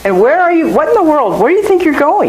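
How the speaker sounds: high, exasperated parent scolding; mock exasperated parent. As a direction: demand it like a fed-up parent.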